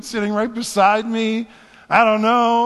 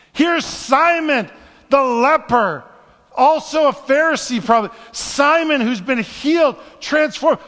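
higher and louder, driving each name home; full-force preaching; 8 semitones higher, 5 dB louder than usual. Acted. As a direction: preach it, building with each example.

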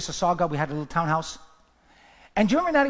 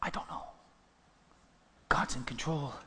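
soft whispered reassurance; 5 semitones lower, 9 dB quieter than usual. Real, not acted. Pastor reassuring the congregation, whispered. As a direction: breathe it like a secret. Hushed aside in sermon.

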